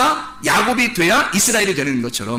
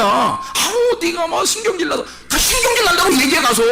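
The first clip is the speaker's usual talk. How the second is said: half-shouting go tell him; hammed-up impatient pastor; mock exasperated shout; 9 semitones higher than usual. Voicing blunt pastor's advice.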